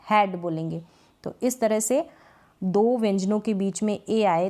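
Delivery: ordinary talk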